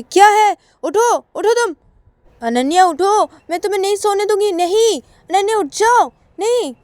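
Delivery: very high, loud demanding cries; insistent shouting. Acted. Payal will not let Ananya sleep, insistent shouting. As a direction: shout at a sleeper to get up, refusing any excuse.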